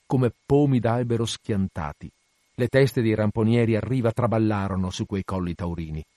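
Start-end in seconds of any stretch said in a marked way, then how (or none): none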